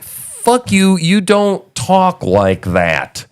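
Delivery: slow, high, drawn-out scolding; scornful retort. Acted. Host confronts a pandering official, indignant and scornful. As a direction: call out the pandering, each word stretched.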